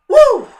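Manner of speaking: very high, very loud whoop of joy; wild whoop. Real, not acted. Fan cheering at trailer description, loud high whoop. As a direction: let out a big fan cheer.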